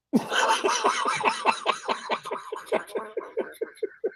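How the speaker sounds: long high wheezing laugh; helpless high-pitched cackling; real belly laugh. Real, not acted. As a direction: crack up at your own joke. Laughs at own joke.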